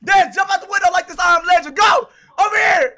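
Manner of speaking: high, fast, loud shout; shouted game orders. Real, not acted. Player ordering teammate out the window, urgent shout. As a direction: yell urgent orders over the gunfight.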